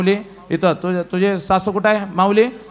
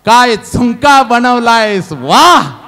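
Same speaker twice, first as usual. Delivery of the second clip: hammed-up admiring exclamation, louder; loud retold praise; 4 semitones higher, 7 dB louder, much slower than usual. Husband praising his wife's cooking, loud hammed-up admiration. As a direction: gush loudly, stretching out the "वाह".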